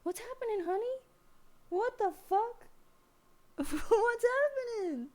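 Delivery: alarmed pet talk. Real, not acted. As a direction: squeak it out in shocked concern. High voice to scared cat.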